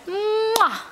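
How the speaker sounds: kiss sound. Shown as a demonstration. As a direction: blow a big kiss for the baby. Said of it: "Blow a kiss".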